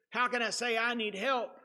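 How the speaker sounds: usual speaking voice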